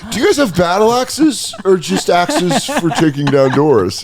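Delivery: put-on high, slow childlike questioning; curious kid. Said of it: "'cause you just wanna ask".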